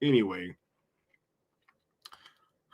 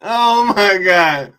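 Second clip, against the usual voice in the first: slow, louder, laughing out of it; slow laughing disbelief; 7 semitones higher, 7 dB louder, slower than usual. Real, not acted. Streamer to chat, slow loud disbelief. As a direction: draw it out as the laugh fades.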